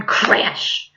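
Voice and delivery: plain reading voice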